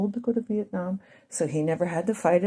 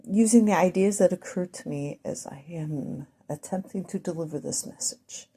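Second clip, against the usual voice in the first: usual speaking voice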